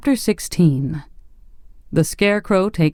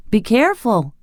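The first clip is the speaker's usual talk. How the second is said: higher, alarmed warning; urgent cry; 8 semitones higher than usual. A narrator's reading. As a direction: stop him before he spills it. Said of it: "cried the boy".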